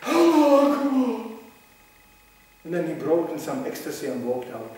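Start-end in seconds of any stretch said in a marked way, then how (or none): 0.1-1.3 higher, louder, slow ecstatic cry; ecstatic shout. Retold or imitated. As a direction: cry out in rapture before execution. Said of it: "he was going".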